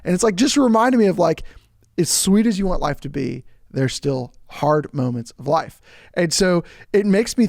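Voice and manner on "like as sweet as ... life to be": ordinary talk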